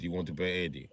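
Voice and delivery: usual speaking voice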